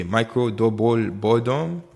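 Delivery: slow, hammed-up Haitian accent; playful Haitian accent. Trader re-explaining the chart pattern, exaggerated Haitian accent. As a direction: draw it out in a mock-heavy Haitian accent. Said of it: "if you don't understand my accent".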